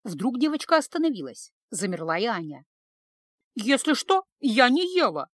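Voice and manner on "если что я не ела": mumbled through a mouthful; mouth full. Acted. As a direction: talk with food still in mouth. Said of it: "прошамкала она, не успев прожевать".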